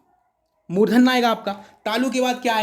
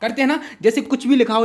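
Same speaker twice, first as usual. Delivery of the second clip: normal talking voice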